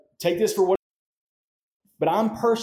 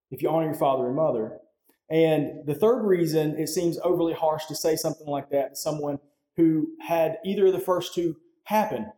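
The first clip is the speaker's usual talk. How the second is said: usual speaking voice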